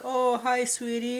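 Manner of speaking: a hint of a doting father; lightly voiced daddy. Dead daddy greets his girl, lightly voiced cooing. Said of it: "Daddy's like".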